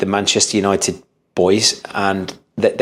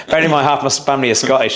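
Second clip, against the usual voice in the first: ordinary talk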